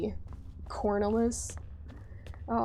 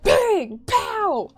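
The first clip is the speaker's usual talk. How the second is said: high, louder punchy bang pow; sound-effect shout; 10 semitones higher, 6 dB louder than usual. Retold or imitated. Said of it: "network said".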